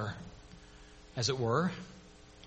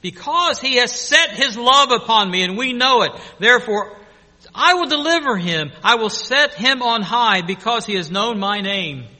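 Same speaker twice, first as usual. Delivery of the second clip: reading scripture; 4 semitones higher, 6 dB louder than usual. Real, not acted. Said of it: "Psalm ninety-one".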